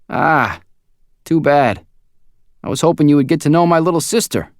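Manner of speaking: chatty and easygoing; friendly coworker. A narrator's reading. Gus voiced by narrator.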